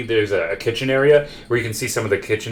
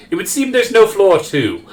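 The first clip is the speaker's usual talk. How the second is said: high, slow, louder butler voice; puzzled old-fashioned butler, pitched up and drawn out; confused butler; 10 semitones higher, 5 dB louder than usual. Acted. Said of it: "So he looks at it confused".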